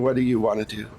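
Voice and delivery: normal talking voice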